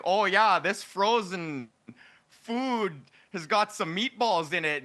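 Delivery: put-on slow, drawn-out Alberta accent read; folksy Alberta accent, high and slow. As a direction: lean into the Alberta accent, voice-over style. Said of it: "my so-called Alberta accent".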